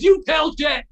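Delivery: gruff retold order. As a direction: bark it like a cranky old pro. Les's curt retold message.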